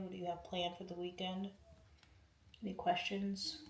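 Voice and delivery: ordinary delivery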